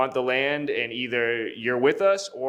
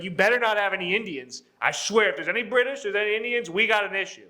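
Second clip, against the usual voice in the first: high-pitched mock threats; mock shit-talking; 6 semitones higher, faster than usual. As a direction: imitate a cocky trash-talker laying down warnings. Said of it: "he's just shit-talking".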